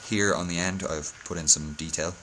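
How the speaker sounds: normal talking voice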